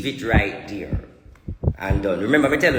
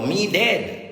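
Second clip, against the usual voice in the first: ordinary talk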